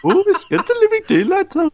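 high-pitched mock Indian accent; hammed-up Indian cartoon accent, very high; Apu. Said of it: "sounding like Apu from The Simpsons".

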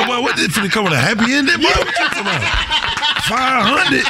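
hammed-up outrage at the barber; mock disbelief. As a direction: act shocked at a ridiculous bill.

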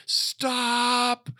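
sudden high yell of Stop!; retold yell. Pastor yells to the bus driver to stop, a high sudden yell. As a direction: shout it like you need the bus halted now. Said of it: "I yelled out to the bus driver".

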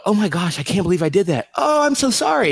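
mock remorse, pitch leaping up high; aghast apology. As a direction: imitate someone shocked at their own mistake. Voicing a humble person's remorseful reaction.

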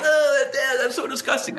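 high, laughing groan of disgust; children chorusing their disgust with a laugh; grossed-out kids. Retold or imitated. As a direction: recoil at the glass like a kid. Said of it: "and they're all like".

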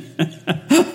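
high giggling burst; helpless real laugh; burst of laughter. Real, not acted. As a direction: break up laughing. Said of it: "I'm laughing a lot".

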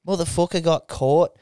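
put-on broad English pub accent; put-on English lad's accent; English pub bloke. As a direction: gossip like an English bloke over a pint. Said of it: "some English guys sitting around going".

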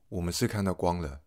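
ordinary talk, nothing marked

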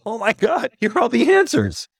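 high, thrilled retold exclamation; amazed discovery. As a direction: relive the eureka moment, voice soaring. Retold delight at finding answers.

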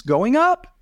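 "going up" said with the pitch climbing at the end; rising tune. Shown as a demonstration. Coaching a presenter, demonstrates ending "going up" on a rise. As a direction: let "up" lift high like a question. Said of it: "you need to end some of those sentences".

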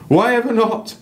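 very high, loud North English exclamation; a red-faced northern guide egging them on; North English accent. Acted. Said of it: "random North English accent".

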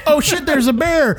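high, laughing fake panic; mock alarm. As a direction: pretend to spot a bear. Joking campfire scene.

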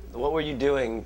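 ordinary talk, nothing marked

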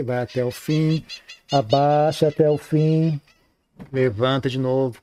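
slow, drawn-out words showing the full bow; slow demonstration. Shown as a demonstration. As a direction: stretch each word like bowing down slowly. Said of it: "você começa aqui". (demonstração lenta e arrastada)

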